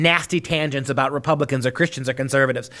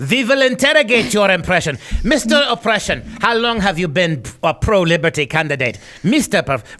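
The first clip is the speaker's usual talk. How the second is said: hammed-up German accent, louder; bad German accent; 3 semitones higher, 4 dB louder than usual. Co-host mocking the professors' phrase as an interrogator, bad German accent. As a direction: play a mock German interrogator, badly. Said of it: "That's a bad German accent".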